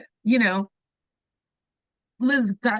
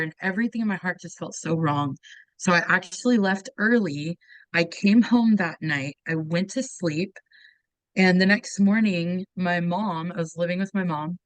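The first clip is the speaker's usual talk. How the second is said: normal talking voice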